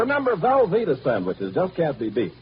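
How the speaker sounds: ordinary delivery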